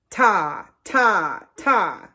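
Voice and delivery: detached ta, ta, ta. Shown as a demonstration. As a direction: tap out each ta crisp and apart. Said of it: "ah shape versus".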